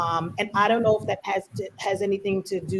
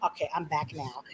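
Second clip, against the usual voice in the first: quiet aside; 5 semitones lower, 11 dB quieter than usual. Retold or imitated.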